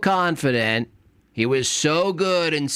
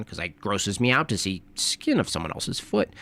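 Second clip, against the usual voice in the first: normal talking voice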